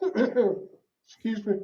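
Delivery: slow, quiet, holding back tears; a real catch in the throat; choking up. Real, not acted. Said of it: "It's okay".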